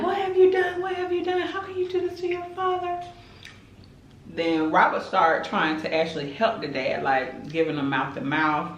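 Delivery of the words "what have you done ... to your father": mother wailing at her son in shock; screaming mother. Retold or imitated.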